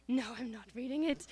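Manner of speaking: restrained, complaining refusal; mock sulky refusal. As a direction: refuse like a sulky kid, not yet yelling. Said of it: "'cause I was complaining".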